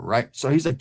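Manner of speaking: ordinary talk, nothing marked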